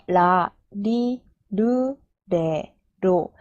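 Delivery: 라, 리, 르, 레, 로 drawn out, each apart; syllable-by-syllable demo. Shown as a demonstration. Teacher showing the R row to learners, syllable-by-syllable demo. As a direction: recite 라, 리, 르, 레, 로 slowly with light R onsets. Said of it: "R 발음이 붙어서".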